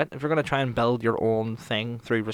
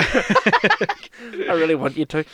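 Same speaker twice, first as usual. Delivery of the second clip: high, loud, broken up by laughter; words stretched out by laughing; 5 semitones higher, 6 dB louder, much slower than usual. Real, not acted. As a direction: plead through real laughter. Laughing while egging friend on.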